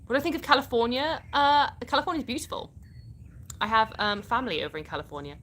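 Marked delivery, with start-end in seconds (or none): none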